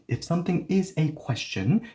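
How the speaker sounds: ordinary talk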